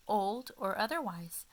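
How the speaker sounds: ordinary delivery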